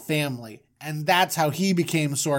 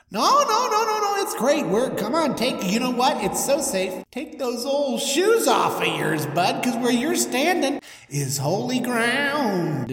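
high, excitable God voice; over-eager God; 7 semitones higher than usual. Acted. God reassuring Moses by the bush, high and eager. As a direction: coax chummily, pitched up, stretching the end.

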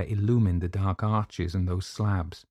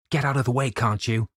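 higher-pitched impatient shout; 5 semitones higher, 3 dB louder than usual. Acted. Narrator shouts at the dark figure in his path, shouting.

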